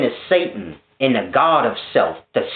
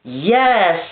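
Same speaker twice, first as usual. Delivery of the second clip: mock divine claim; 6 semitones higher, much slower than usual. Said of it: "he's like".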